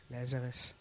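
low, soft call of Lazarus; a soft call that gets corrected; quiet calling. Acted. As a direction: murmur "Lazarus" gently, low. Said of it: "No, the Bible says this".

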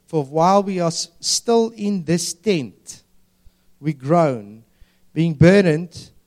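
slow, measured Bible verse; scripture reading. Acted. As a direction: read it out deliberately, as proclamation. Reads scripture aloud.

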